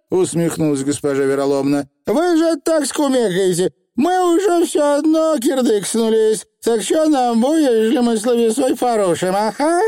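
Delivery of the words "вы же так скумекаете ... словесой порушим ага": very high dialect voice; knowing Feegle-style patter. A narrator's reading. Witch mimicking Feegle reasoning, high and knowing. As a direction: needle them in a little rogue's tongue.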